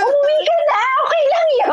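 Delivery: high, laughing go home; laughing reassurance. Retold or imitated. Sending crying boyfriend home, laughing.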